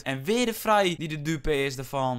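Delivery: ordinary talk, nothing marked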